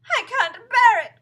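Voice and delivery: high, loud, drawn-out wail; distraught cry. A narrator's reading. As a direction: wail in despair. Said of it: "cried Missus Twit".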